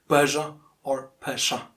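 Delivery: Persia as PUR-zhuh, then PUR-shuh; two correct variants. Shown as a demonstration. Teacher offering both ways, two variants of Persia. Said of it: "pronounced in two different ways".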